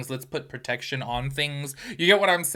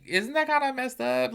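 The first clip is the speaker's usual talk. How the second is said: shocked; 11 semitones higher than usual. Retold or imitated. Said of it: "y'all, I'm like".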